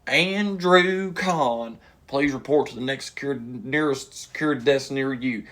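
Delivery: public-address page with a stretched-out name; mock intercom announcement. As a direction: imitate a casino paging announcement. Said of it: "all of a sudden I hear".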